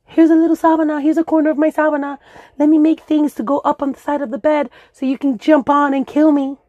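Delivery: high, sing-song mock offering voice; mock sweet offer. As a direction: play the sweet host inviting the killer in. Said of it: "to just".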